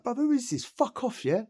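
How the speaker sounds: high, cocky brush-off; high mocking self. New inmate brushes off the taunting neighbour, high and cocky. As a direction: toss it off high and cheeky. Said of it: "I'm like".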